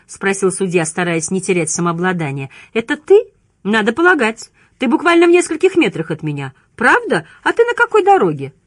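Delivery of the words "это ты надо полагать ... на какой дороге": high, loud, animated phone voice; pitched-up, lively villain; gleeful taunting. A narrator's reading. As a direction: toy with the listener in a raised voice.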